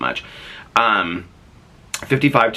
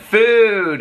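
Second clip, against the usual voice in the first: high, loud one-word cheer; retold excitement; 6 dB louder than usual. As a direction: imitate her exclaiming it excitedly. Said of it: "She was all excited about that".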